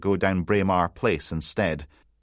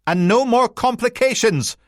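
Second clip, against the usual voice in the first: much higher and louder, shouted after him; called out after him; 6 dB louder than usual. Acted. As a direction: fling it up the street as a parting order. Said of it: "her voice rang out behind him".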